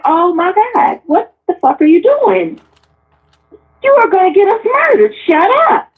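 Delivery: mortified panic. Retold or imitated. Panicked at dad's public outburst.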